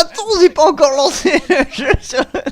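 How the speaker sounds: high, exasperated plea breaking into laughter; real helpless protest; flustered laughing protest. Real, not acted. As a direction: beg the game to wait, half laughing. Protests to game, game not waiting.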